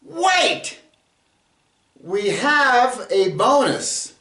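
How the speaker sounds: a high, abrupt "Wait!" called out; sudden wait. Acted. Host calls viewers back for a bonus, high and abrupt. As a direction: stop them before they leave.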